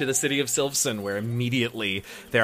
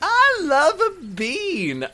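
high, slow, gushing; delighted and drawn out; excited; 3 dB louder, much slower than usual. Real, not acted. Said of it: "I'm just so excited about the bean".

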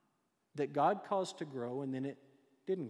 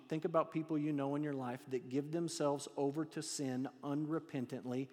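ordinary talk, nothing marked

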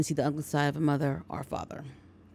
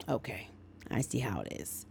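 whispered, under the breath; a hushed aside, barely voiced; whispered retort; 9 semitones lower, 12 dB quieter than usual. Judy answering the insult, retold.

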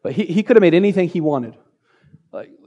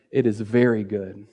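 tender God voice; 8 semitones lower, 6 dB quieter than usual. Acted. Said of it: "He says".

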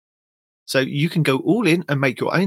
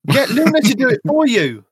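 high, raised-voice suggestion; exasperated retort; 10 semitones higher, 5 dB louder than usual. Real, not acted. Host answering a co-host's complaints, exasperated retort. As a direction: push back loudly, voice leaping up.

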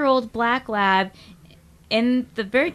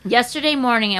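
ordinary delivery

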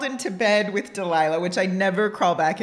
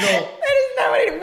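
laughing protest; 14 semitones higher, 5 dB louder than usual. Real, not acted.

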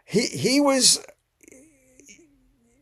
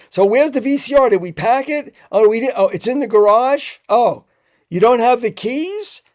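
higher and louder, impatient yelling into the phone; 7 semitones higher, 6 dB louder than usual. Retold or imitated. Director yelling at his housekeeper about the VCR, loud and impatient. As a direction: yell at the housekeeper over a missing VCR. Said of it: "like, yelling".

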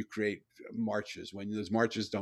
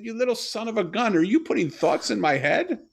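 much higher and louder, thrilled disbelief; excited outburst; 11 semitones higher, 9 dB louder than usual. Retold or imitated. Father shouting to his daughter in thrilled disbelief, high and loud. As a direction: burst out like a father stunned with joy.

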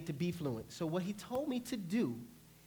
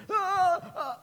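high, loud mock panic gasps; hammed-up frightened gasping; panicked gasps; 12 semitones higher, 6 dB louder than usual. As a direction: imitate someone frozen in panic. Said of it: "But if you're like".

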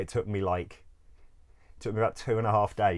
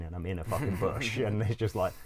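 ordinary talk

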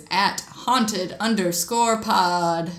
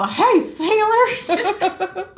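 high, slow drawn-out catcall; flirty ghost; 10 semitones higher, much slower than usual. Pretend. Ghost haunting people with a drawn-out catcall. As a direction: call out to a passing sailor. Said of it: "haunt people and go".

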